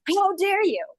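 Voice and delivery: high, indignant how dare you; mock outrage. Scene: replying to a rude social media post, mock outrage. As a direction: play it huffy and offended.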